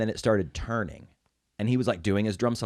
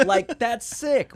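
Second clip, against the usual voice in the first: higher, slower gushing; excited, drawn out; 13 semitones higher, 5 dB louder, slower than usual. Real, not acted. Excited about girlfriend's father.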